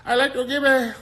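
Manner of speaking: very high, hammed-up Spanish accent; mock Spanish accent. Host parodies Latin Music Awards presenter, hammed-up Spanish accent. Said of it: "they talking with a Spanish accent".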